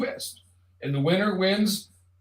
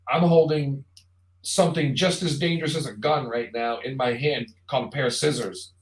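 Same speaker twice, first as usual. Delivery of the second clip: ordinary delivery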